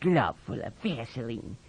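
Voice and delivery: low, quiet disgust; sour, softly spoken jibe. A narrator's reading. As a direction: mutter it with contempt. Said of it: "he said disgustedly".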